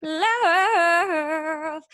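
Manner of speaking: "Love" belted high and loud with a little R, like "lurf"; big high "Love" with an R tinge. Retold or imitated. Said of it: "a little R every time she says the word love".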